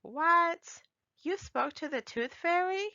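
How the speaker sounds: high, astonished question; mother can't believe her ears; surprised mom. A narrator's reading. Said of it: "Mom asked".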